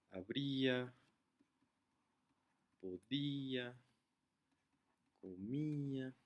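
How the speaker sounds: spaced-out examples, stretching the í in "habría, podía, comía"; broken diphthong. Shown as a demonstration. Teacher listing accented words, "habría, podía, comía" with a drawn-out stressed í. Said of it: "estamos rompiendo un diptongo".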